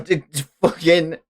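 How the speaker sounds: usual speaking voice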